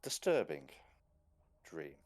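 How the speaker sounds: put-on a proud old man insisting he is fine; stiff old gentleman. Gentleman Hubert answering Louise, stiff and proper.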